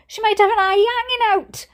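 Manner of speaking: high, fast frantic yell; panicked mother yelling. A narrator's reading. Mother fearing for her injured sister, frantic yell. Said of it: "yelled my mother".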